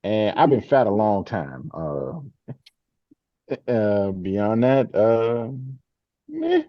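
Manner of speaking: ordinary talk, nothing marked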